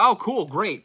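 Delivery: high, loud mock enthusiasm; sarcastic. Scoffing at his own overlong video, sarcastic. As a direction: sneer it out like it's great news.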